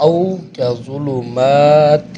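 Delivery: او کا ظلمات drawn out slowly with a held او; tajweed demo. Shown as a demonstration. Teacher shows students how to read او کا ظلمات, slow and drawn out. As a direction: stretch او before ظلمات, slow and clear. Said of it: "اس کو پڑھیں گے ہم".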